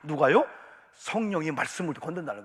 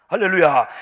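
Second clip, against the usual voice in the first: shouted hallelujah to open; loud hallelujah; 15 dB louder than usual. Real, not acted. Preacher rousing the congregation with a loud hallelujah. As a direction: call it out with full force.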